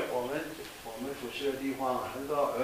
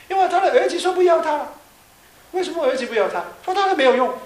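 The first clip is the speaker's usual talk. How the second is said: loud, high, indignant retelling; indignant outcry; 13 semitones higher, 11 dB louder than usual. Retold or imitated. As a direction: cry out at the injustice. Indignant retelling of abandoned old friend. (高声愤慨地转述)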